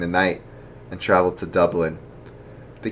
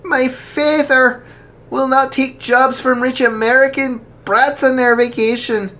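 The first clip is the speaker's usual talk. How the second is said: proud and defiant; 5 dB louder than usual. Acted. Daughter defying the rich American, proud and loud. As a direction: stand tall and refuse. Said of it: "stood tall".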